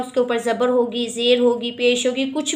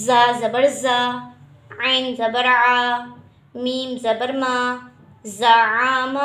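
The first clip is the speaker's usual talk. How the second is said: slow spelling drill; much slower than usual. Shown as a demonstration. Teacher spells letters aloud in drill.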